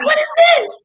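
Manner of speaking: very high mimicked squeal of outrage; imitating a furious falsetto; high squeaky voice. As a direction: squeak it in mock disbelief. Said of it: "this high squeaky voice".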